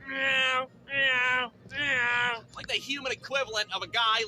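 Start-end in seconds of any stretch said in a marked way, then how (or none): 0.1-2.4 loud, held-out cat yowl; yelled cat meow. Retold or imitated. Cat in heat on the floor, yelled meows. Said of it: "more like yelling".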